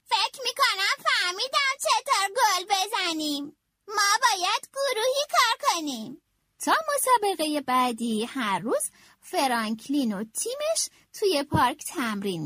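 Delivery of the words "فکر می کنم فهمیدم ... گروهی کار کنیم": put-on higher, thoughtful child voice; thoughtful Franklin. A narrator's reading. Franklin explaining his idea to his teammates, a thoughtful, higher voice. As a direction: share the idea, a little proud of it. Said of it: "دستی روی سر خودش کشید و گفت".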